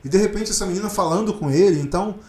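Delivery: ordinary talk, nothing marked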